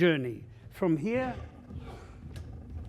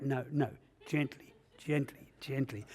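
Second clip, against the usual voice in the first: much softer, soothing hush; soft and gentle; 4 semitones lower, 12 dB quieter than usual. Pretend. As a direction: ease off as if handling something fragile. Coaxing himself to handle artifacts.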